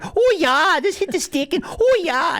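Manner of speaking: very high, loud mock cheering; happy Canadian fans. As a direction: squeal like delighted hockey fans, mockingly. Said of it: "and so happy".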